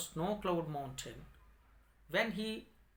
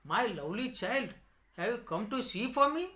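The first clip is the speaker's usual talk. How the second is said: lightly raised, quick gentle bird voice; a touch higher and faster, coaxing the child; bird of happiness; 6 semitones higher, 4 dB louder, much faster than usual. Acted. Magic bird greets the blind boy, lightly raised quick voice. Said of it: "The bird of happiness asked him".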